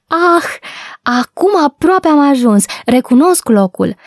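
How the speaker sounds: high, happy exclamation; joyful cry. A narrator's reading. Girl recognises the public garden, crying out joyfully. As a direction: exclaim with delight, pitch lifted. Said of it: "Poliana a strigat veselă".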